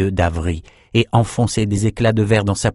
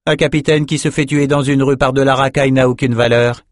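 higher, scornful put-down; mépris cinglant; 6 semitones higher, 3 dB louder than usual. A narrator's reading. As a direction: dismiss him with irritated contempt. Maxime foudroie le garde, méprisant. (réplique méprisante, voix plus haute)

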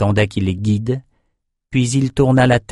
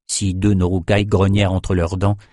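ordinary delivery

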